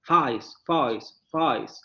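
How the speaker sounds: "face" repeated as fais, first vowel opened to a; Cockney face. Shown as a demonstration. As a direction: say "face" with an open fais diphthong. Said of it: "van a decir face". ("face" repetido como fais, vocal abierta)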